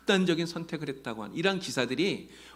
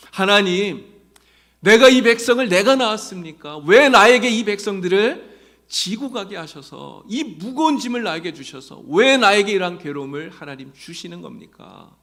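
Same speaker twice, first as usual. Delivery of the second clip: much higher and louder, arguing with God; demanding protest; 7 semitones higher, 12 dB louder than usual. Retold or imitated. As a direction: fling the grievance at God. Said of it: "따지듯이 하나님 앞에 기도하고". (하나님께 따지듯 높고 크게 항의하는 기도)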